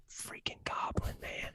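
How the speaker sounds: hushed whisper, no voice at all. Retold or imitated. Retelling the movie to a podcast co-host, whispering. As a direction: breathe it out as a whisper. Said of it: "we're like".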